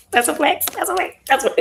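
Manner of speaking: high, sing-out mock brag; playful boasting. Author joking about having written a book, playfully boastful.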